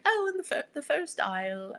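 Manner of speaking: put-on high-pitched stab at a British accent; British accent that falters partway; attempted British accent. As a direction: imitate a British shop woman's accent, badly. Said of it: "I can't do the accent now".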